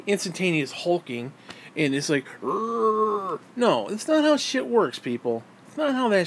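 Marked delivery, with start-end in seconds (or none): 2.4-3.4 hulk growl. Retold or imitated. Imitating hulked-up growl.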